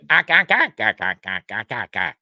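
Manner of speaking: staccato Martian chatter, loud burst dying down; Martian ack-ack. Retold or imitated. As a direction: rattle off acks like a movie Martian. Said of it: "so just read it all like that".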